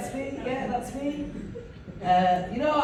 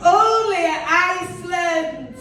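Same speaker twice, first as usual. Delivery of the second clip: slow, higher, louder tagline; 9 semitones higher, 4 dB louder, much slower than usual. Shown as a demonstration. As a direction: land the slogan like an advert. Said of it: "It's always, like, Iceland".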